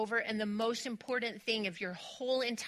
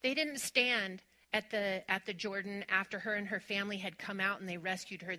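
normal talking voice

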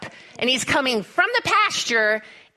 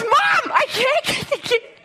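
urgent shout; 9 semitones higher than usual. Retold or imitated. Son shouts to mom from the stuck gate, urgent. Son yells while bull approaches.

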